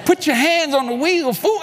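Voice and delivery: high, insistent scolding command; bossy inner voice. Retold or imitated. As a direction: order a fool to pay attention. Repeats the comic command.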